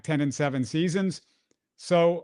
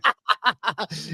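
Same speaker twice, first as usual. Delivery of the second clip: laughs at his own line; can't keep a straight face, chuckle; short amused laugh; 6 semitones higher than usual. Real, not acted. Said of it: "I tried to say it with a straight face".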